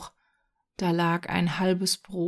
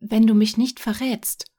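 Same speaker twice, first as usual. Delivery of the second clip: sudden, louder and higher; quick; 4 semitones higher, 7 dB louder than usual. A narrator's reading. Boy stops the departing man, blurting quickly. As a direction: blurt it out urgently. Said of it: "sagte Jürgen da schnell".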